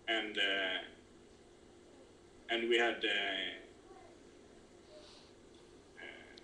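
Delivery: catching in the throat mid-sentence; choking. Real, not acted. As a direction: voice choked, fading out. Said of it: "sorry, I'm cho-, choking sometimes".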